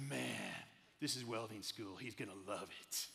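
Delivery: low, easygoing welding teacher voice; laid-back teacher. Retold or imitated. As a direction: drop low and shrug it off like a big tradesman. Welding teacher quoted casually.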